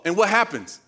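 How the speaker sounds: ordinary talk, nothing marked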